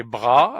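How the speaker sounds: bras said with an open drawn-out a; syllable bras. Shown as a demonstration. Teacher showing learners how to read bras. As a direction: say bras as in the table. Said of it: "avec un A, ça fait".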